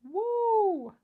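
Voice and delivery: excited woo. Real, not acted. Cheering a viewer's haul.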